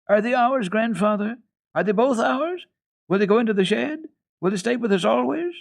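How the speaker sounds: put-on high, eager rush of questions, little girl; a thrilled little girl who can't stop asking; delighted child. Heidi pestering grandfather about the goats, high delighted child voice. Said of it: "one question following the other in her delight".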